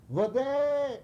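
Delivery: high, drawn-out shouted call; imitating the chief's desperate scream for water. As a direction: cry it out like a yell from high up. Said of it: "and he screams".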